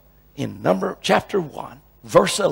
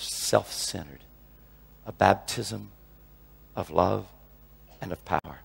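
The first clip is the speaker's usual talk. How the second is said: hushed preaching; 5 semitones lower, 8 dB quieter than usual. Acted. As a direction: drop to a near hush and linger. Hushed closing appeal.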